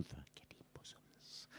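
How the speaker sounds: cheeky unvoiced whisper to the crowd; whispered aside. Real, not acted. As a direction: lean in and whisper the naughty bit.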